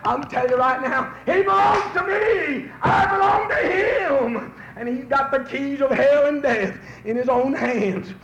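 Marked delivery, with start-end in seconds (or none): 1.3-4.5 preached shout. Acted. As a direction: cry it out as your own faith. Preacher's climactic declaration.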